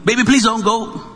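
hammed-up begging; mock pleading. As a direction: beg a lost thought to stay, playfully. Mock plea to lost thought.

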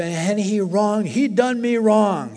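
loud, high, drawn-out grievance; aggrieved. Retold or imitated. Preacher mimicking his old grudge to the church, aggrieved. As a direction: stretch out the wrong, loud and high.

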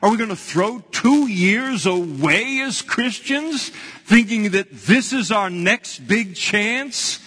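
fervent preaching. Acted. Preacher rousing Christians not to waste years. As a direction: drive the point home to the congregation.

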